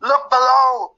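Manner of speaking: high call over the speaker; pointing it out through the mic. Retold or imitated. [name] telling him to look down, high called. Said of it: "He's like".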